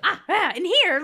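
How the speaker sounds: hammed-up villain finding his prey; creepy little man voice. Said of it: "And he's like".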